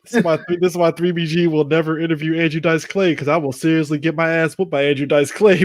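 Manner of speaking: usual speaking voice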